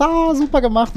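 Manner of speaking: high, overdone praise; a sugary put-on cheer; mock praise. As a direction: gush with fake applause. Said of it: "wir haben alle gesagt".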